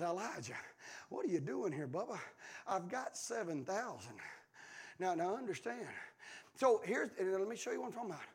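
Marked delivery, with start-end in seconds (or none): 0.0-2.2 low, much softer calling; gentle voice of God. Pretend. God calling Elijah in the cave, soft and low. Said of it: "God doesn't always yell". 2.7-4.2 low, much softer, slow; soft voice of God. Pretend. God reassuring Elijah, low and soft. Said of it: "God doesn't always yell".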